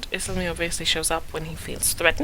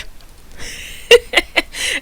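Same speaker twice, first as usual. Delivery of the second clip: laughter; 6 dB louder than usual. Acted. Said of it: "He bursts into sudden laughter".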